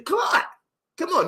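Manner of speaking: very high, hammed-up keenness; eager agreement. Flattered man agreeing to stroll with stranger, high mock eagerness. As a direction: chirp it high, playing too eager.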